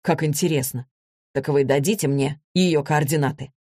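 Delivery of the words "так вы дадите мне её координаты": ordinary delivery, nothing marked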